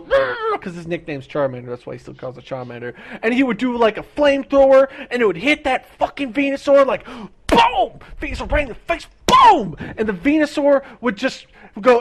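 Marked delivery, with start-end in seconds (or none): 0.0-0.6 high, playful growl; mock Charizard roar. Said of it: "the Charizard will be like". 9.3-9.8 boom. Retold or imitated. Sound effect of the hit.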